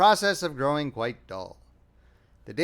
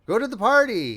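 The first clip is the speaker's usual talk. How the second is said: raised, louder order; commanding; 7 semitones higher, 7 dB louder than usual. A narrator's reading. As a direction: give a confident command. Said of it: "he commanded".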